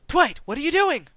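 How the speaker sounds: high, loud startled demand; alarmed question. Acted. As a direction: confront Dwight in alarm.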